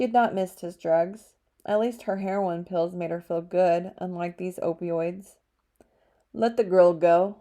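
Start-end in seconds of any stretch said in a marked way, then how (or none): 6.3-7.4 firm, commanding man's voice, louder; strong velvety male voice. A narrator's reading. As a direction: order it with calm authority. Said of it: "a strong, velvety male voice said".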